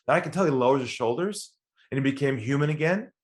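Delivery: ordinary talk, nothing marked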